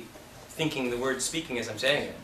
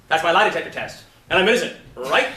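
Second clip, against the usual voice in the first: loud, higher, mismatched comeback; stuck line reading; 4 semitones higher, 8 dB louder than usual. Retold or imitated. Coach mocking an actor who can't break his line reading, too loud. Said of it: "this actor can't break his line reading".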